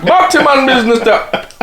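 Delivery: high-pitched mock yardie, laughing. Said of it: "them yardies are loud a bit".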